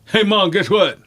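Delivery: high, loud tattletale call; put-on high, eager announcement; brother's voice. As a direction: imitate a brother calling out to mom. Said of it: "All of a sudden, I hear".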